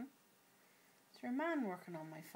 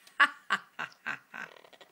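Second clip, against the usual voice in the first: louder, lower staccato ha-ha laugh; posh laugh; 4 semitones lower, 8 dB louder than usual. Shown as a demonstration. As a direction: demonstrate a posh laugh in crisp, separate bursts. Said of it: "Do posh laugh".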